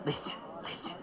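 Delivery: whispered, urgent listen listen; hushed urgency. Acted. Seliger warning Engel in an urgent whisper. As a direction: pull him close and whisper.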